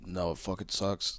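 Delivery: low, quiet mock bottle voice; a gruff little answer from the bottle; whiskey bottle voice. Bottle answering the mic, low and quiet. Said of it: "holding up my microphone to Crown Royale".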